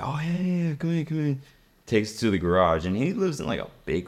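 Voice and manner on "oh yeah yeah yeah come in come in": high-pitched mock eager host; excited, high "come on in" voice; eager welcome. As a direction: imitate a buddy waving friends in. Acting rich kid welcoming friends in.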